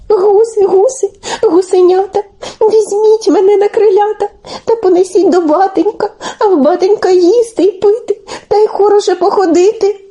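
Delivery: high little begging chant; imploring. A narrator's reading. Telesyk implores the third flock of geese, high and pleading. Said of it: "так їх благає, так просить".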